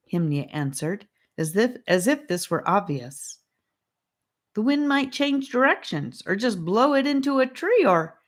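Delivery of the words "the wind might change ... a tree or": put-on worried boy. A narrator's reading. Charlie worried objection.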